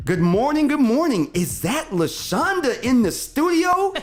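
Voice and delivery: high, lively read-aloud greeting; bright reading voice. Retold or imitated. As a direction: read it with life and character. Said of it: "that's an exclamation and A question mark".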